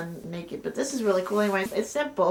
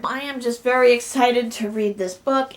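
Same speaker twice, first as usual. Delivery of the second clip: normal talking voice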